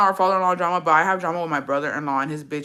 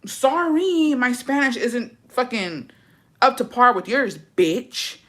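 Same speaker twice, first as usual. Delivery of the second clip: sarcastic comeback; 6 semitones higher, much slower than usual. Retold or imitated. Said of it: "And I was like".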